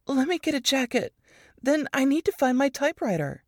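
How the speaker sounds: lightly voiced stubborn young woman; a touch of brisk insistence; Ruth determined. A narrator's reading.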